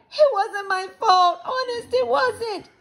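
put-on high, loud panicky girl voice; crying protest. A narrator's reading. Girl denying blame for the fall, crying out. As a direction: wail the excuse in a high pitch. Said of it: "Sheila cried".

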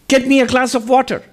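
high, loud command; high-pitched old swami. Retold or imitated. Old swami ordering novices, high loud command. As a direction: bark the order in a thin high voice.

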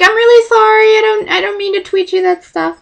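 high, meek voice; retold embarrassed fangirl; sheepish apology. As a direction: apologise in a small squeaky voice.

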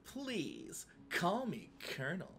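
breathy, very soft lusty drawl; lusty Colonel Sanders. Acted. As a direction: purr it like a flirt.

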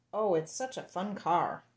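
ordinary talk

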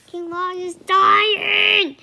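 loud, high, drawn-out proclamation; booming. A narrator's reading. Christmas knight announcing to the king, booming. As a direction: boom it out, stretching the words. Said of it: "the Christmas knight boomed".

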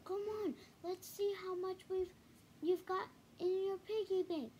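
a hint of a bossy big brother; lightly voiced young cub. A narrator's reading. Brother voiced, higher child voice.

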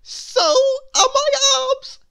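very high, loud, jolly character; cheerful. A narrator's reading. Mister Tickle talks of his long arms, cheerfully. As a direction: whoop it out with glee. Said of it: "said Mister Tickle cheerfully".

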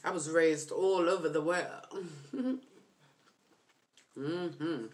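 chewing crab meat; talking between bites; mouth full. Real, not acted. Seen 1: picks crab meat from shells, brings it to mouth around 1.5s.

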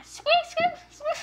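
very high squeaky bunny; one piercing little bunny squeak; chocolate bunny squeak. Pretend. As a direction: squeak like a toy rabbit. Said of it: "saying squeak".

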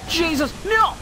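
high, panicked cry; alarmed shout. Real, not acted. Attacked by more laser beams.

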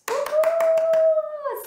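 high, loud whoop; excited cheer. Real, not acted. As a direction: let out a long cheering woohoo. Cheer after the goodbye song.

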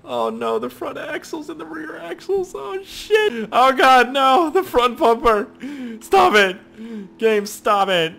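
high, loud anguished wail; mock anguish. Gamer wailing at his car being torn apart, hammed-up. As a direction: wail like watching a friend get hurt. Watching car get wrecked.

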